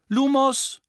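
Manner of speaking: spell called out. Acted. Casting a light spell.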